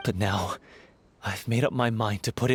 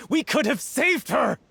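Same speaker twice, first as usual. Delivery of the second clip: higher, grief-stricken yell; anguished outcry; 10 semitones higher than usual. Acted. Grieving lover blaming Viamant, anguished. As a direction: cry it out in despair.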